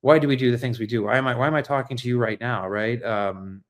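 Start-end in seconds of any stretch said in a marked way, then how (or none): none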